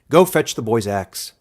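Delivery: elder's order. A narrator's reading. Elder ordering a young man, loud.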